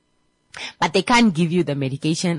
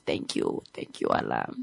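whispered thanks; 9 dB quieter than usual. Retold or imitated. Patient's tearful whispered thanks.

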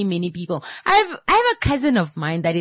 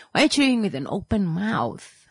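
normal talking voice

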